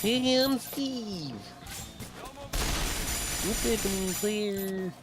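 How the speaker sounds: very high, slow cheesy dude voice; bad voice acting. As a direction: overdo a cheesy game character. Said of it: "that bad voice acting".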